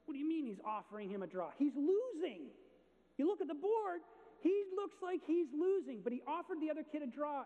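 high, loud, disbelieving; incredulous outburst. Retold or imitated. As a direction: protest that he's losing. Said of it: "And he's like".

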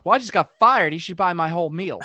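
imitated loud public complaint; real loud. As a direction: announce it for the whole room to hear. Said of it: "he says real loud".

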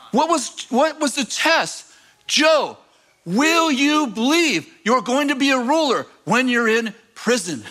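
full-force preaching. Acted. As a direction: preach it up to the rafters.

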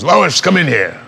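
high, loud call to Lois. Retold or imitated. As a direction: Superman summoning Lois proudly. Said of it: "He goes".

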